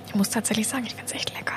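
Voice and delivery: hushed whisper. Real, not acted. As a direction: whisper it like a secret. Quiet tasting aside.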